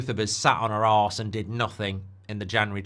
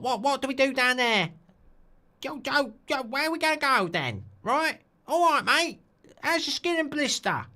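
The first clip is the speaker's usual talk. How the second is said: Essex accent; 12 semitones higher, 3 dB louder than usual. Shown as a demonstration. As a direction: ham up a squealing Essex geezer accent. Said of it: "Mark, do an Essex accent, please".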